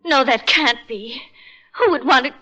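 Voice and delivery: ordinary talk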